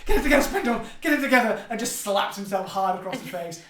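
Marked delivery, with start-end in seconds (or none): 0.0-1.6 high, loud self-reproach; frantic self-scolding. Acted. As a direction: yell at yourself to snap out of panic. Spindle scolds himself, slapping his face.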